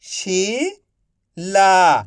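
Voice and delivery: slow stretched reading. Shown as a demonstration. Teacher reading to pupils, slowly stretched. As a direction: draw the word out slowly. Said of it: "मैं किस तरीके से".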